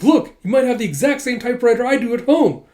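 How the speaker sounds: high, loud defensive protest; hammed-up desperate backpedalling; panicked excuse. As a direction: argue frantically, caught out. Caught director makes frantic excuse.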